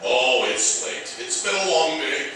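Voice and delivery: normal talking voice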